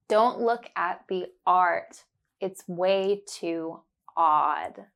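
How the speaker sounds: slow, deliberate, every ah clear; slow and careful. Shown as a demonstration. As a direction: spell it out slowly for the learner. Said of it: "say it slowly and focus on the sound".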